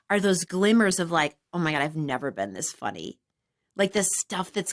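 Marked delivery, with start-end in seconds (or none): 1.5-3.2 soft awe. Retold or imitated. Stoned self-delight, low soft.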